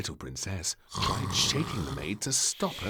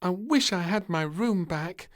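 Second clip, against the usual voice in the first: put-on high, louder child's lament; despairing; 12 semitones higher, 7 dB louder than usual. Princess kept awake by snoring, despairing and high. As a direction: wish it with a sigh of despair. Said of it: "thought little princess despairingly".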